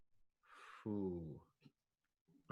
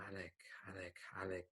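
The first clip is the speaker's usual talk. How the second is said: soft, sighing reproach; lightly voiced chiding ghost mentor; 4 dB quieter than usual. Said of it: "in a chiding kind of tone".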